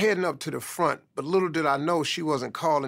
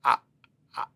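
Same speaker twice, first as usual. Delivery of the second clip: stuttering; 3 dB louder than usual. Retold or imitated. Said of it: "I started to just stutter and stammer".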